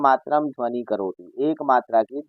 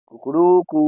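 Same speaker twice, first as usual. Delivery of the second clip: higher, louder rooster crow with a stretched last कू; 4 semitones higher, 3 dB louder than usual. Shown as a demonstration. Teacher imitating the rooster's long final crow for children. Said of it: "मुर्गा last में कहता है ना".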